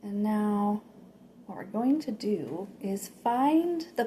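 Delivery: slow, gently paced meditation voice; guided meditation. Acted. Meditation host leading listeners to picture red fruit, slow guided meditation. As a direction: guide them slowly, with long pauses.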